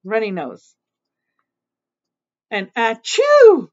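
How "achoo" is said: loud mock sneeze, drawn out; big fake achoo; demonstrated sneeze. Shown as a demonstration. Reader showing the child what a sneeze is, mock sneezing. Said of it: "that's a sneeze".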